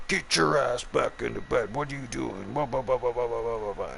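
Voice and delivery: high mocking voice of a nagging woman, slowed on the first words; nagging girlfriend.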